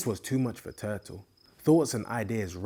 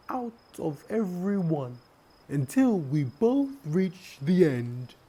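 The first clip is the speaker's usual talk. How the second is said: high, measured turtle voice; scheming turtle; 8 semitones higher, 3 dB louder than usual. Acted. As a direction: lay out the clever plan. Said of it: "the turtle said".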